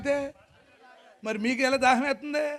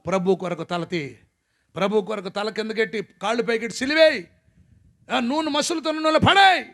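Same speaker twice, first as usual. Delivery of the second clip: louder barked orders of persecutors; a preacher voicing persecutors' orders, louder; mock cruel command; 8 dB louder than usual. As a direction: act out the torturers giving orders.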